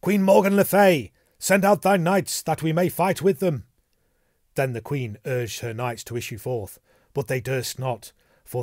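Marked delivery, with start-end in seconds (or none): 0.0-3.6 loud, high summons to battle; crying aloud. A narrator's reading. Gawain challenges the queen's knights outside her castle, crying aloud. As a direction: call up to the castle, projecting hard. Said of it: "Sir Gawain cried aloud".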